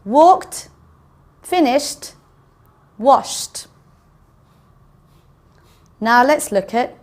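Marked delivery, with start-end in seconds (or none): 0.0-3.7 WALKED, FINISHED, WASHED each ending in a crisp T; teacher modelling a T ending on Walked, finished, washed; T sound at the end. Shown as a demonstration. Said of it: "sounds as though there's a T at the end".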